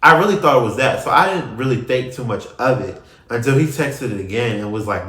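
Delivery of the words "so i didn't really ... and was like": ordinary delivery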